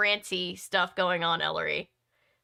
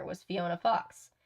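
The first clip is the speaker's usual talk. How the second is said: plain reading voice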